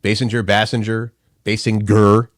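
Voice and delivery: "Basinger" tried first with a long a, then with a short a as "Bassinger"; trying pronunciations. Shown as a demonstration. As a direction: test out "Basinger" and "Bassinger" one after the other. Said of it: "doesn't know how to pronounce".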